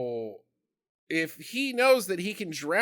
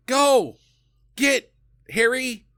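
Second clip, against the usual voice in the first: shooing yell; 9 semitones higher, 4 dB louder than usual. Retold or imitated. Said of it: "she could have just been like".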